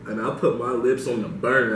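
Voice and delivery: ordinary talk, nothing marked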